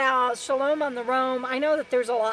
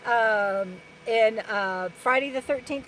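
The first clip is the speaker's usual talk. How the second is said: ordinary talk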